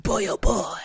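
low-pitched imitation of a failed accent; mock tone-setting. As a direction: mimic his low, toneful delivery. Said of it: "You're setting a tone".

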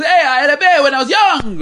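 put-on high, loud, overdone Russian accent; hammed-up Russian accent as Khabib; Khabib's voice in a clumsy Russian accent; bad Russian accent. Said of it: "that's not really Russian".

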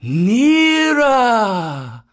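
high, loud, long-drawn snake call; hissed command. Acted. Kaa drawing the monkeys closer, drawn-out hissed command. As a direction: stretch it out and let it fall. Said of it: "hissed Kaa".

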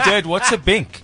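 put-on a small boy asking his dad, slowly and high; imitating a young son. Young son asking his dad about the ad, high and slow. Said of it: "He said".

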